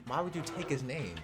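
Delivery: ordinary talk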